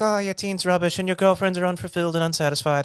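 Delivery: put-on high, fast taunting Irish accent; cheeky Irish wind-up voice; goofy Irish accent. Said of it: "in this goofy Irish accent".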